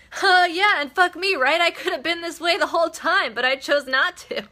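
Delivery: high, loud, gleefully exclaiming; gleeful retold quote. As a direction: burst out like you've only just realized it. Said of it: "And she was like".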